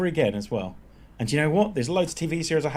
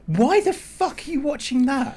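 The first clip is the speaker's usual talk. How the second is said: incredulous; 12 semitones higher than usual. Real, not acted. Disbelief at friend's TV show.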